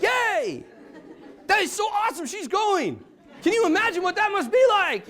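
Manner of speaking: high-pitched mock delight; hammed-up excited cheering; over-the-top gushing. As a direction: gush for the winner to hide your relief. Covers relief with gushing for winner.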